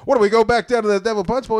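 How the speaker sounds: high hurried imitation of himself; own suggestion. Retelling his own suggestion.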